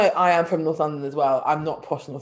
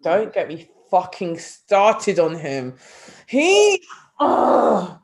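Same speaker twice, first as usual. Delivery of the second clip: loud, drawn-out exasperation; exasperated; 2 semitones higher, 4 dB louder, much slower than usual. Real, not acted. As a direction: groan with disgust at the very thought. Exasperated rant about Hugo.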